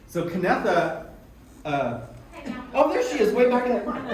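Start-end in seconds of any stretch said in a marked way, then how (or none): 2.7-4.0 delighted spotting. Real, not acted. Spots an organizer in the room.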